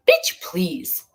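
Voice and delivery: high, breathy scoff, toss it away; scoffing dismissal. Real, not acted. Streamer scoffing at those trying to silence her, dismissive. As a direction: scoff at the haters.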